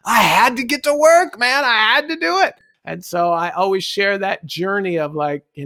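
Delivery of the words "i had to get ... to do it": high, emphatic excited plea; insistent protest. Retold or imitated. Valet explaining the trash truck to onlookers, excited protest. Retells his defensive reply.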